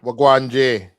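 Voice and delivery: Jamaican greeting, Wagwan J called out loud; playful Jamaican accent greeting. Pretend. Co-host must speak Jamaican until beer is done, Jamaican accent. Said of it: "speak in a Jamaican accent".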